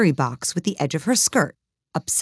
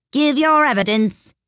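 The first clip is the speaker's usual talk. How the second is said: high, imperious royal order; commanding king; 9 semitones higher, 4 dB louder than usual. A narrator's reading. King to the cook in court, high command. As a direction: order the witness in a raised pitch.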